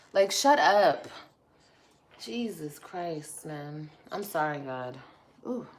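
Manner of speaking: normal talking voice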